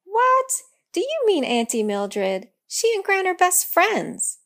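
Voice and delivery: high, loud surprised outburst; shocked disbelief, voice jumping up; puzzled cry. Acted. Girl hearing about Auntie Mildred, high and loud in surprise. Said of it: "she cried, puzzled".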